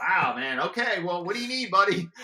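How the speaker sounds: mock coworker happily giving in to the caller; eager to help. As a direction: won over and happy to help. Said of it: "they're like".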